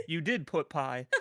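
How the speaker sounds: ordinary delivery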